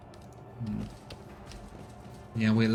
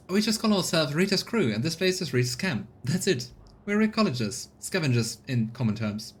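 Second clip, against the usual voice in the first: higher, proud woman's voice; tired colonist woman; 5 semitones higher than usual. Acted. Voicing Rita character.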